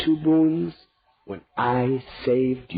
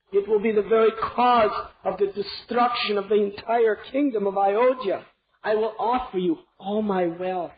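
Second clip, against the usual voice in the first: much higher, desperate pleading; begging; 9 semitones higher than usual. Acted. King at queen's feet, begging desperately. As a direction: beg her on your knees. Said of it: "he begged her".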